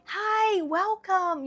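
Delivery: high, bright fake-happy hello; forced cheerful greeting. Greeting guests with forced high cheer. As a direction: put on a smiley people-pleaser welcome. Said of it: "you need to be happy and smiley and be like".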